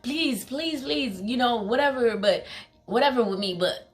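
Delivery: mildly acted urgent begging; retold pleading. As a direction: plead quickly, lightly acting the desperate dad. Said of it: "I could tell he was pleading with them".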